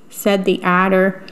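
plain reading voice

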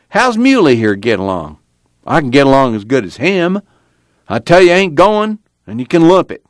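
put-on an old man digging in his heels; crying out angrily. A narrator's reading. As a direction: Grandpa defying the family, angry outburst. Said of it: "Grandpa cried".

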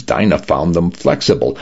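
usual speaking voice

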